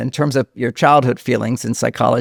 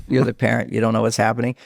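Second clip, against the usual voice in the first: usual speaking voice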